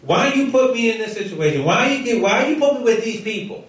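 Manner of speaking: high-pitched exasperated griping; complaining. Acted. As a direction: complain as a fed-up Moses pleading with God. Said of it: "he's complaining".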